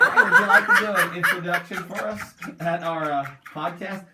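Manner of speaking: loud laughing invitation. Retold or imitated.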